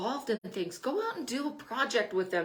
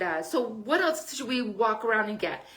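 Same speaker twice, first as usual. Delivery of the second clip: usual speaking voice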